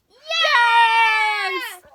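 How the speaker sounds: very loud high shout; crowd shout. Acted. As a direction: roar like a crowd.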